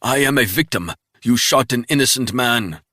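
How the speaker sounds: mock him from the ground; sneering captive. Wounded spy taunting Resnick with a sneer.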